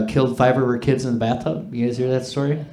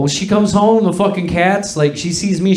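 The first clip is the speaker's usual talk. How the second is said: ordinary talk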